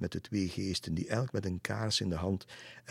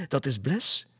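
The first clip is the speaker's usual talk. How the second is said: higher, louder cry of recognition; exclaiming; 7 semitones higher, 5 dB louder than usual. A narrator's reading. Lisse recognises the farm horse, exclaiming. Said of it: "riep Lisse uit".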